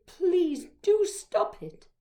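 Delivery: drawn-out, insistent entreaty; pleading. A narrator's reading. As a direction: beg the bully to stop, drawing the words out. Bullied Helen begs Charlie to stop.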